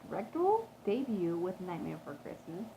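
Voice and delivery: plain reading voice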